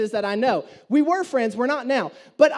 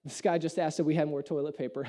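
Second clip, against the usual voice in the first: mocking clerk; 10 semitones lower, 8 dB quieter than usual. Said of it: "I hear him say".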